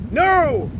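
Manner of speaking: shouted no. A narrator's reading. Captain shouting no to Wyatt's demand. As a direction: bark the refusal. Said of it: "shouted the captain".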